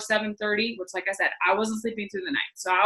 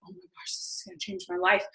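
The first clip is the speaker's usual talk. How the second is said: much softer, almost breathed realization; hushed awe; 3 semitones lower, 9 dB quieter than usual. Retold or imitated. Reader retelling her reaction to the book, hushed awe. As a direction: say it under your breath, in awe.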